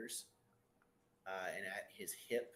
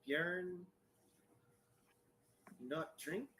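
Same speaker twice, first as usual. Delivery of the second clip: put-on higher, halting Elvish-accented broken words; a shy elf struggling with the language; broken-speech elf; 7 semitones higher, much slower than usual. Bjorn speaks broken common tongue.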